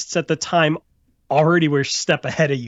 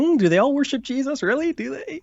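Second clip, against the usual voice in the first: sarcastic, pitched way up in disbelief; mock disbelief; 10 semitones higher than usual. Real, not acted. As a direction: tease the idea with high mock questions. Mocking rhetorical doubt.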